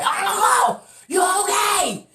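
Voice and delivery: high, drawn-out holler; retold scream. Screaming to unanswering Uncle in the breezeway, high shout. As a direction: scream it out down a hallway. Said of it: "now I'm screaming in the breezeway".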